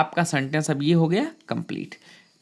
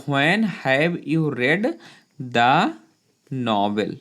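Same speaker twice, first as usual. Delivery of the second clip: slow dictation; much slower than usual. Shown as a demonstration. Teacher dictating a sentence.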